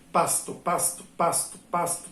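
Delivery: pasto repeated with effort, each closed syllable PAS held; laboured repetition. Shown as a demonstration. Teacher showing pasto is harder to repeat, laboured repetition. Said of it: "Dá mais trabalho para pronunciar do que pato".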